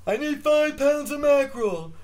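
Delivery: put-on deaf girl voice. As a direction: squeeze it up high and mushy like the deaf girl in the joke. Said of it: "And the guy's like".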